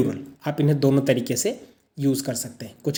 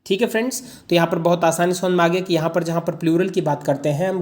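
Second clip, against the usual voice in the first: usual speaking voice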